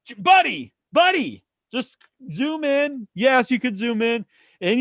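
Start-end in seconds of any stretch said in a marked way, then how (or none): 0.1-3.1 high, exasperated plea. Retold or imitated. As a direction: beg him, fed up.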